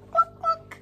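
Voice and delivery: high, loud mock hen cluck. Teacher voicing the hen in the story, high clucking.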